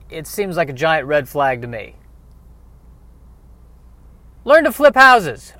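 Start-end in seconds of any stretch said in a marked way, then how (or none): 4.4-5.5 loud, high pitch-man shout of the slogan; hyped-up ad slogan. Pretend. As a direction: sell it like a cheesy infomercial.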